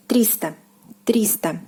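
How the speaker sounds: триста stressed on its first syllable, "TREE-sta"; триста, first syllable stressed and the rest light. Shown as a demonstration. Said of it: "stress falls on the first syllable".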